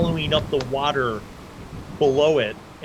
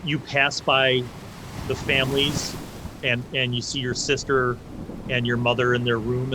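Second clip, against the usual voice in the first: usual speaking voice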